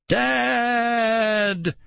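long, high call; screaming. A narrator's reading. Girl screaming for her father, high long scream. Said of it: "Nicole screamed".

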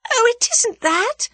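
high, slow, on the edge of hysterical laughter; half-laughing, half-panicked denial; almost hysterical laugh. A narrator's reading. Tuppence denying it is about money, near-hysterical laugh. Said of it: "with an almost hysterical laugh".

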